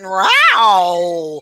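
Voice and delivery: imitating a cat's meow; a mock meow like the cat. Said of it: "and she went".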